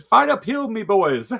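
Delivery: hammed-up Irish accent breaking into laughter; squeaky mock Irish accent. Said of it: "He said".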